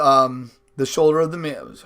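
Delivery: ordinary delivery, nothing marked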